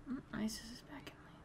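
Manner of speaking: fast whispered callout; whispering. Real, not acted. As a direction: whisper it quickly.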